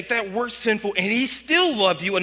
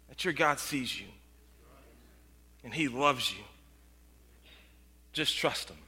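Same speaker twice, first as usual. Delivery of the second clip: much softer and lower, intimate; soft reassurance; 7 semitones lower, 10 dB quieter than usual. Real, not acted. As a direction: lean in and speak gently.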